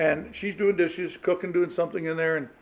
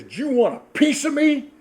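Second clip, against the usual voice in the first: higher, louder mock threat; stern dad; 8 semitones higher, 4 dB louder than usual. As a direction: sound furious to scare the boys. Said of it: "I wanted them to think I was".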